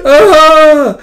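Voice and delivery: high shrieking cry of alarm; scream. Acted. Crying student screams out in alarm, piercing shriek. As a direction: let out a sudden piercing shriek. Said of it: "Just screams".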